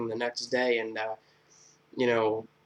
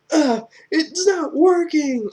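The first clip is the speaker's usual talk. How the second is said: higher, louder, slow crying wail; mock crying wail; 8 dB louder, much slower than usual. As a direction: blubber it out in fake despair. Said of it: "And he's like".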